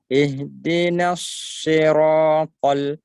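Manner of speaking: "siratal" with heavy, thick sa, ra and ta, slow and loud; heavy letters. Shown as a demonstration. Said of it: "ra tebal, ta tebal".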